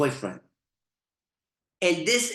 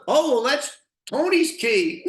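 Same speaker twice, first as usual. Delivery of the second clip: high, giggling retelling; barely holding back laughter; laughing through it; 7 semitones higher, 4 dB louder than usual. Real, not acted. Telling the story of the girlfriend's excuse, laughing. Laughing while quoting her.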